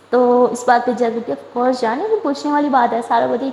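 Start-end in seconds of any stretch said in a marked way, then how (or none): none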